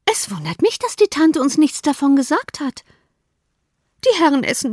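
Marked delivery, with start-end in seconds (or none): 0.8-2.8 put-on higher girlish complaint; Lydia. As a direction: pout it like a spoiled young girl. Lydia's voice continues.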